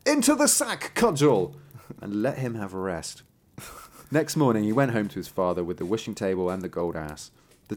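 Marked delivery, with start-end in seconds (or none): 0.0-1.5 high call sending the cudgel back; cried out. A narrator's reading. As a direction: call it home with a raised voice. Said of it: "he cried".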